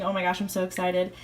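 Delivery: ordinary delivery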